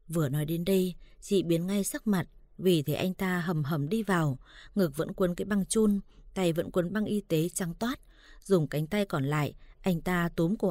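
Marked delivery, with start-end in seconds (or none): none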